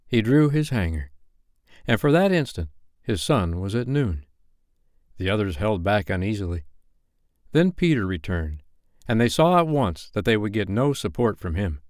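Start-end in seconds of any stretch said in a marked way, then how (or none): none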